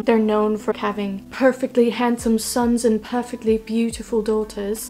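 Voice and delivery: a badly faked posh British accent, lightly put on; hammed-up British accent, not quite landing; terrible British accent. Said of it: "That was a terrible British accent".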